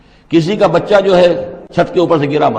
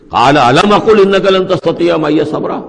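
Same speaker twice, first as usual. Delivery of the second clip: recited higher and louder, with a scolding edge; mild scolding; 5 semitones higher, 5 dB louder than usual. Shown as a demonstration. Khidr rebuking Moses a second time, louder scolding recitation. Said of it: "ذرا ڈانٹ کے انداز میں کہا".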